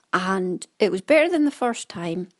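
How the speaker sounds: ordinary talk, nothing marked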